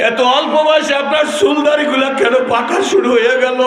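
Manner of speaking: high, loud chanting storytelling; full-force preaching. Acted. Preacher voicing Aisha answering her husband, loud high cry.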